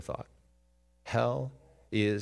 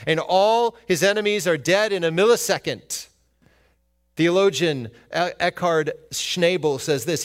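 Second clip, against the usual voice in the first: higher, louder preaching build; full-force pulpit preaching; 6 semitones higher, 7 dB louder than usual. Acted. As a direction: drive it home to the congregation.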